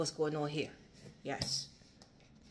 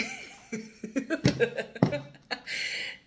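high, loud burst of laughter; 9 semitones higher, 4 dB louder than usual. Real, not acted. Reader laughing at the cards, loud and high. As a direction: laugh out in delight.

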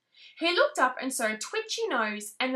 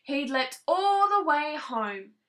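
plain reading voice

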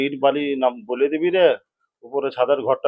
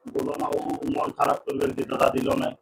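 whispering; 8 dB quieter than usual. Real, not acted. Hushed talk about brother-in-law.

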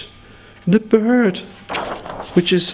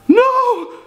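mock outcry; 3 dB louder, slower than usual. Mock outrage at own price.

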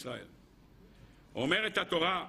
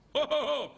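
laughing; 8 semitones higher, 3 dB louder than usual. Real, not acted. Laughing at the silencing.

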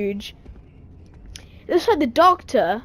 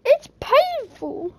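high pleading whine; whiny plea; 8 semitones higher, 3 dB louder than usual. Real, not acted. Pleading with chef.

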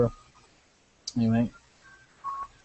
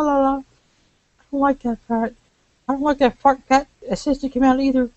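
put-on higher, indignant young woman; snippy and offended, higher; 9 semitones higher than usual. Voices a woman character.

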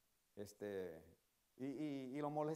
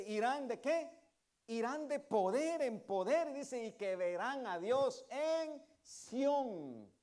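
louder, slow ringing scripture proclamation; preaching the verse; 8 semitones higher, 9 dB louder, much slower than usual. Acted. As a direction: proclaim it to the congregation. Preaching verse at full force.

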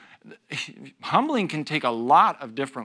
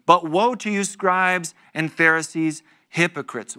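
louder, declaiming the woe; scripture proclamation; 3 semitones higher, 5 dB louder than usual. Acted. Pastor reads Jesus' woe to the Pharisees, declaiming. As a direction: proclaim it like a solemn warning.

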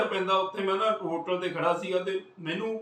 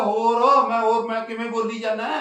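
exasperated; 4 semitones higher, 7 dB louder than usual. Retold or imitated. Exasperated at friend at gate.

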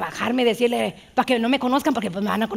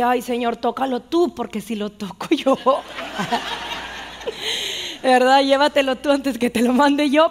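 laughing mock prayer. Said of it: "me puse a orar por él".

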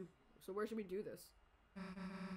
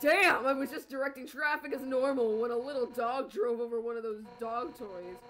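loud traffic cop voice; 10 dB louder than usual. Acted.

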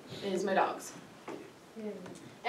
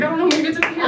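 dog's voice; 9 semitones higher, 11 dB louder, much faster than usual. Retold or imitated. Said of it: "He's like".

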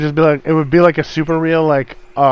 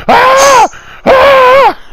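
two high, loud mock screams; piercing mock shrieks, full volume; human-like deer scream; 10 dB louder than usual. Imitating deer running through the woods, mock human scream. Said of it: "They just scream like people".

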